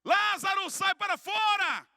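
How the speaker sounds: high, loud cry with drawn-out final words; commanding shout. Retold or imitated. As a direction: call the dead man out at full voice. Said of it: "ele dá um grito".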